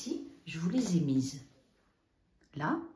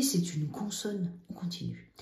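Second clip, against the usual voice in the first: ordinary talk, nothing marked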